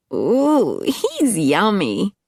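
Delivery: put-on high, slow drunken purr; tipsy flirt. Tina ogles Angus.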